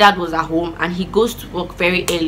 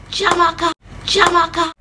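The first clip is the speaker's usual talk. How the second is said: high-pitched imitation of mother summoning; put-on voice of a calling mom; 9 semitones higher, slower than usual. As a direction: mimic a mother calling her child, last call drawn out. Angry mother calling in story.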